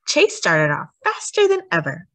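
usual speaking voice

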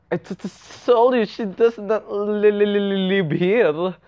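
"li-li-li-live" stammered in a long run; exaggerated stutter. Playful exaggerated stutter in a quote.

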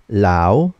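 "lao" long, dipping low then rising; third tone. Shown as a demonstration. Teacher drilling learners in third tone, "lao" dipping and rising. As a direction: sink down on "lao" and climb back up. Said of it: "One more time".

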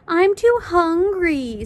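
high, loud drawn-out whine; sing-song childish complaint; whiny excuse. Acted. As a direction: whine it slowly, stretching the last word. Classmates join in the whining.

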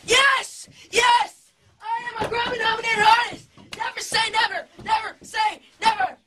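very high, loud celebratory yell; excited shouting. Acted. Singer showing fans his delight at a Grammy nomination, shouting excitedly. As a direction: shout it out in wild triumph. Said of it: "show you guys how I really feel".

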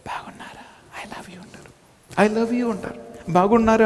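soft tender whisper into the phone; loving whisper. Shown as a demonstration. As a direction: whisper sweetly to someone you love. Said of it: "Prematho మాట్లాడుతుండే". (Prematho గుసగుస)

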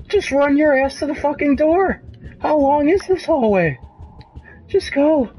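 squeaking with nerves, pleading to get out; voice going up. Real, not acted. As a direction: let fright push your voice right up high. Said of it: "My voice is going up".

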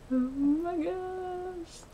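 high, long drawn-out squeal; overwhelmed excitement. Real, not acted. Election winner alone after the news, overwhelmed squeal. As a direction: stretch it out high in disbelief.

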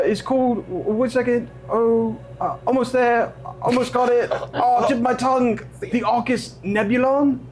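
put-on high, fumbling, halting kid voice; a flustered schoolboy searching for words; nervous kid voice. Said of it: "and he says".

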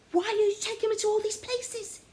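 frustrated complaint; exasperated. Acted. Oliver complaining to Rumpus, exasperated.

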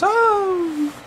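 high yelp of a scared dinosaur; cowardly dinosaur cry. Retold or imitated. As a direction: squeal like a frightened dinosaur. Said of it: "He goes".

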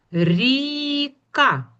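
ріка with a long high І, then a short КА; sound shown drawn out. Shown as a demonstration. Teacher showing the new word to children, drawn out. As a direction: stretch the І in ріка, separate the КА. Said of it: "у замінили на і".

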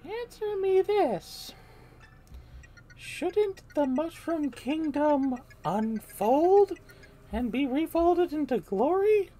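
high, very slow and drawn out; eerie princess voice. Acted. Brainwashed Peach questions Mario, high and eerie. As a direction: float it up high, creepy and slow.

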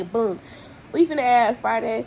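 ordinary talk

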